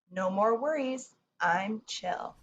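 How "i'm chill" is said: ordinary talk, nothing marked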